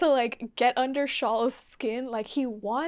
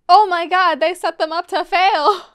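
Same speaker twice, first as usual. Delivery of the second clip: loud, raised outburst of outrage; exclaimed realization; 3 semitones higher, 11 dB louder than usual. Acted. Viewer acting out their own realization, loud dismay. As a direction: blurt it loudly as the awful truth lands. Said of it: "Now I'm like".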